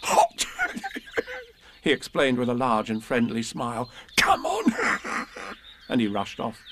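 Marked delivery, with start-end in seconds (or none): 0.0-0.5 much higher, louder happy cry; cheerful yelp. A narrator's reading. Tigger cheerfully tells Pooh and Piglet, high and loud. 4.1-5.3 much higher, eager bounding call. A narrator's reading. Tigger calls his friends along, high and eager.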